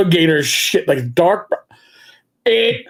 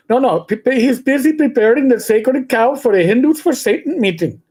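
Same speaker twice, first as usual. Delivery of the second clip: put-on Indian accent, higher-pitched; 5 semitones higher than usual. Shown as a demonstration. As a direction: answer the phone in a mock Indian accent. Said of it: "I always use the, the Indian accent".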